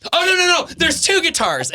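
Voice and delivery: high, frantic correcting; mock flustered. To a friend, correcting a movie mix-up in a high frantic voice. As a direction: correct yourself in a panic, voice up high. Mimicking confused movie chat.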